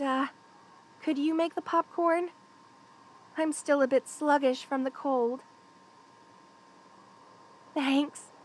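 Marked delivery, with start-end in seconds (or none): none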